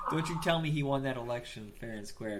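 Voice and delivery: normal talking voice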